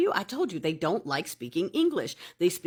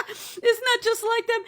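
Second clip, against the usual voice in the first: laughing. Real, not acted. Mocking the newcomers' complaints.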